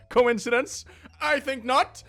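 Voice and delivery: high, loud mock-dramatic reveal; dramatic conspiracy voice. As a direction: ham up the conspiracy punchline.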